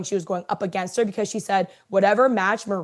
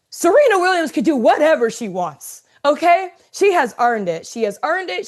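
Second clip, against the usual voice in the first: louder, higher, insistent defense; emphatic defense; 7 semitones higher, 6 dB louder than usual. Real, not acted. Defending Serena before anybody says nothing, emphatic raised voice. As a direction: hammer the point home, raised up. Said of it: "I'm gonna say this right now".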